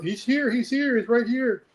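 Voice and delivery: high-pitched mock shout, no real volume; retold screaming. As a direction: call it out high, like a mom shouting downstairs. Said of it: "screaming downstairs to somebody".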